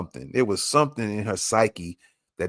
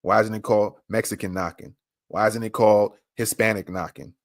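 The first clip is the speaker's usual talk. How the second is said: usual speaking voice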